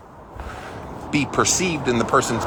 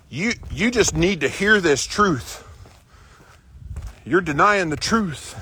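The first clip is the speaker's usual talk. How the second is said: mock critic; 5 semitones higher than usual. Mimicking critics at work.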